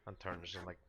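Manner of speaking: ordinary delivery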